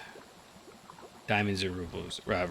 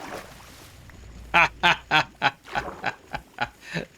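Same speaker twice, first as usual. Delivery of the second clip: breathy laugh; 7 semitones higher, 4 dB louder than usual. Real, not acted. Still laughing at the luck.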